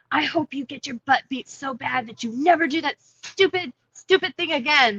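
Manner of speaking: mildly acted angry father; angry dad. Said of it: "my dad got so mad".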